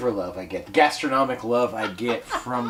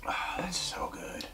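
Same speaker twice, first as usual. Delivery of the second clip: whispered; 13 dB quieter than usual. Real, not acted. Whispered praise of whiskey.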